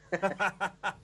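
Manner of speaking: brief amused chuckle; short laugh. Real, not acted. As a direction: laugh off own joke. Laughs at his own joke.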